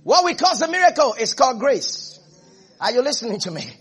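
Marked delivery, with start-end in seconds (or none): 0.0-2.2 high, loud, driving sermon voice; impassioned preaching. Acted. Preacher rousing the church about a harvest of miracles, impassioned. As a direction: build to a ringing proclamation.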